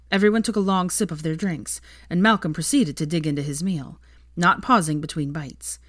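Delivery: ordinary delivery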